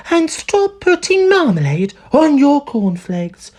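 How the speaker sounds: high, slow woman's reproof; chiding wife. A narrator's reading. Wife scolding husband over breakfast, chiding. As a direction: correct him from across the table.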